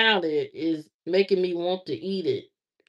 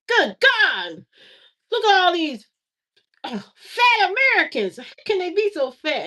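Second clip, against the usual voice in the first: high, amazed tourist voice; shocked foreign visitor; 10 semitones higher, 3 dB louder than usual. Retold or imitated. Vlogger imitating foreigners shocked at fat Americans. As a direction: gasp at the sight like a stunned visitor. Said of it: "they're like".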